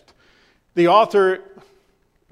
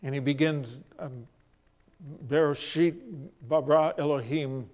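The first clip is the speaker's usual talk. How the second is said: hesitant; 3 semitones lower, 6 dB quieter than usual. Acted. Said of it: "rather hesitant and tentative".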